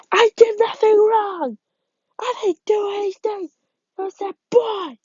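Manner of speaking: put-on high, frantic boy's voice protesting; a scared kid crying out his innocence; panicked boy. Boy in the police car insists he's innocent, high panicked cry. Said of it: "a police car saying".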